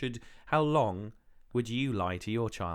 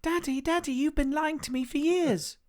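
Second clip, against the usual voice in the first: mock upset child; 4 dB louder than usual. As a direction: play an accusing little child to Daddy. Plays child confronting lying father.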